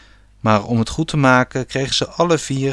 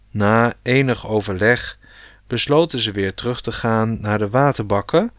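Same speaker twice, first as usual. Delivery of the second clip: plain reading voice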